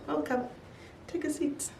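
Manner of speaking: soft, high, menacing calm; ominous quiet welcome. Retold or imitated. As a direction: greet them like a trap.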